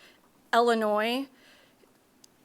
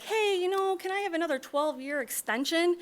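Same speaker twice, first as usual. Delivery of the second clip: asking voice; 8 semitones higher than usual. Retold or imitated. Developer voiced asking for extension.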